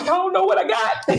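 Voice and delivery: laughing doubt. Retold or imitated. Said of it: "And I'm like".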